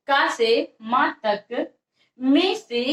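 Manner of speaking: का से मा तक said slowly and evenly; slow dictation. Shown as a demonstration. (धीमी लिखवाई)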